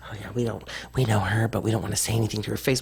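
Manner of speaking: low, hushed, hurried mock gossip; hushed gossip imitation. Mocking locals who talk behind backs, low hushed gossip. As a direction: mimic the two-faced locals under her breath. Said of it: "it's like".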